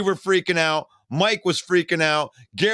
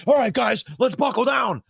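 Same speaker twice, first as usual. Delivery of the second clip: higher, urgent pep talk; rally them, pitched up and pressing; rallying the guys; 6 semitones higher than usual. Retold or imitated. Retelling how he urged friends in the den, rallying.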